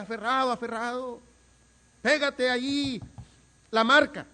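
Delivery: high, loud coaching yell; sideline shout. Retold or imitated. Boy at a neighbourhood match yelling at teammates. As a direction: call out to a teammate across the field. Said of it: "trataba de animar a sus compañeros y le decía".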